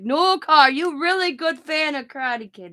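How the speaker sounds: high, emphatic doctor imitation; doctor impression. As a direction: imitate the doctor's accented teasing. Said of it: "And he's like".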